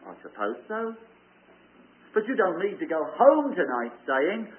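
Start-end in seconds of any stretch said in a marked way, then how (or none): 0.1-0.9 unsure. Pretend. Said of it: "You say".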